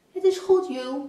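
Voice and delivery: reassuring call, loud and high. A narrator's reading. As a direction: call back to the dog. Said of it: "roept Sanne naar haar". (geruststellend geroepen)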